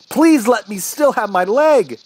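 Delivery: high, loud begging; desperate yell. Acted. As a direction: plead at full voice for your leg. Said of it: "I yelled".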